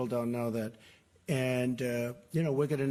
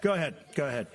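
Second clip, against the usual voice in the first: calling out over the room; 2 semitones higher, much faster than usual. Real, not acted. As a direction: raise the voice to cut through the crowd. Calling on reporters over shouting.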